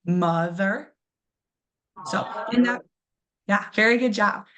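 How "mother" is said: Mother with MO stronger and a light ending; stress on first syllable. Shown as a demonstration. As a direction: model Mother for the class to repeat. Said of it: "say that out loud".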